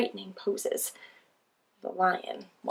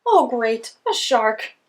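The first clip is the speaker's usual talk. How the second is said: sarcastic; 3 semitones higher, 10 dB louder, slower than usual. Acted. Penguin meets predators.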